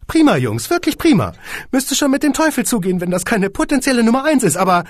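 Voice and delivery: high and loud praise; gushing squeaky boss. A narrator's reading. Boss praises the song, high and loud. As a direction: gush before the catch.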